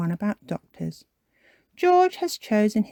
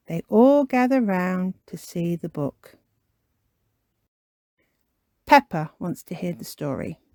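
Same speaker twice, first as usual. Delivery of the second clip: usual speaking voice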